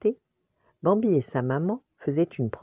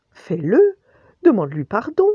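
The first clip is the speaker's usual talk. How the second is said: usual speaking voice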